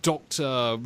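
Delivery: ordinary delivery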